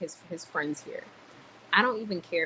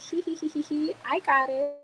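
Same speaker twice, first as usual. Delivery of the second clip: mock giggle; 9 semitones higher than usual. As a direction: play the giddy pushover who happily pays. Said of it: "do you think I was like".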